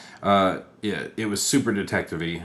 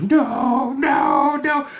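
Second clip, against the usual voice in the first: very high, loud wailing no; spurned fan howling at his idol; Riddler impression; 7 dB louder, much slower than usual. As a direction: cry out in shrill denial. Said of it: "And he's like".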